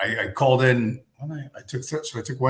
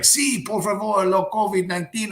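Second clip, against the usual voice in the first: put-on Spanish accent; 10 semitones higher, 3 dB louder than usual. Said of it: "the Colombian guys are like".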